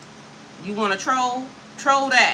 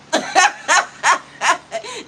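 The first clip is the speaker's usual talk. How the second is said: rising giggle after the joke; high laugh; 8 semitones higher, 4 dB louder than usual. Real, not acted. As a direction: laugh at your own punchline. Laughing at her own joke.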